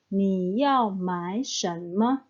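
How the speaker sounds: slow, careful Mandarin model read; slow model sentence. Shown as a demonstration. Teacher reading an example sentence to students, slow and careful. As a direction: spell it out slowly for learners. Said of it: "Jadi arti kalimat ini".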